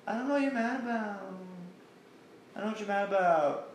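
high, fast, feigned-innocent whine. Retold or imitated. Mimicking Alea denying everything, high and fast. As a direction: toss it off fast and high, playing dumb. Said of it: "it plays the usual".

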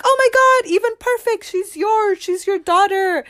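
very high, loud retold exclamation; excited reenactment. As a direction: act out her overjoyed astonishment. Acts out birth mom's joyful reaction.

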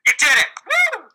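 mock cheer. Real, not acted. Mock celebration of finding a table.

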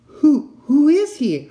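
high, loud, slow frightened stammer. Acted. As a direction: stammer it in fear. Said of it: "I stammered".

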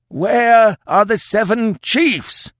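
slow, high, loud howl; howled demand. Acted. Old chief demands his chiefs from Conan, howling. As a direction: howl it out as an accusation. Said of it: "howled the old chief".

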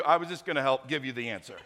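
usual speaking voice

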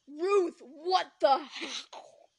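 loud, drawn-out demonic yell; satanic outburst. Acted. Boy shocked at Ruth's video, satanic outburst. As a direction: roar it slowly as if possessed. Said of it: "Satan just emerged from within me".

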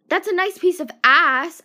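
a hint of a loud catcaller; hammed-up leering call; mock catcaller. Catcaller quote again.

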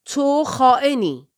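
loud, slow, drawn-out accusation; growled accusation. A narrator's reading. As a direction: growl it at the traitor, stretching the words. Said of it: "غرید".

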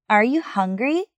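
"hungry" swept upward at the end; yes-or-no question with a clear lift on "hungry"; rising question intonation. Shown as a demonstration. Teacher models a yes-or-no question, rising intonation on "hungry". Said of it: "the intonation rises at the end".

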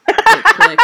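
sudden high, loud squeal of discovery; excited squeal. Real, not acted. Host realizing Marvolo's name at last, an excited squeal. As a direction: shriek it as the penny drops. The reveal finally lands.

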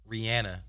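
Rihanna with an "an" as in "and"; correcting how Rihanna is said; Rihanna the corrected way. Shown as a demonstration. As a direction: say Rihanna with a flat "ee-ANN-uh" ending. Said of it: "that we mispronounce her name".

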